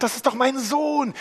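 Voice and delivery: high, loud cry of a father who knows his son; father's joyful recognition. Acted. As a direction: call it out as the overjoyed father. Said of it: "Und er sagt".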